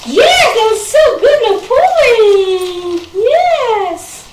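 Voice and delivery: high, loud, slow gushing praise; squealing delighted praise; puppy praise voice. Real, not acted. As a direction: stretch out the praise for the dog.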